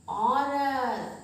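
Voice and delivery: औरत stretched very slowly, vowel held; drawn-out example word. Shown as a demonstration. Teacher to children, dragging the word out slowly. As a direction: hold the औ in औरत long. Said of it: "औ से".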